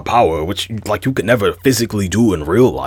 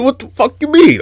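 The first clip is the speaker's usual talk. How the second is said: much higher, louder exasperated outburst; incredulous; 5 dB louder than usual. Real, not acted. Ranting about Goku cheating, incredulous outburst. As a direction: throw up your hands in disbelief.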